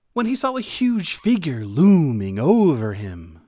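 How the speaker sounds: loud, swelling narration; dramatic storytelling. A narrator's reading. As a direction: make the huge figure loom with big, booming words. Storyteller dramatizes a looming giant figure.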